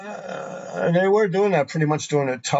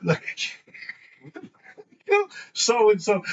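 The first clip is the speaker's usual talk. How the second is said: laughing; 7 semitones higher, much slower than usual. Real, not acted. Laughing while telling the graffiti story, cracking up. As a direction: voice rides up into laughter.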